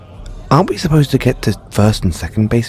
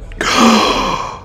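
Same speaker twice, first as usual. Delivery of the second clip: sharp mock-delighted gasp; high little gasp; 11 semitones higher than usual. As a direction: gasp in playful surprise. Hears the prescription line.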